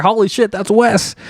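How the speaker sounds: high startled exclamation; surprised. Retold or imitated. Podcaster recalling seeing Wes on screen, shocked. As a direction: blurt it out in shock.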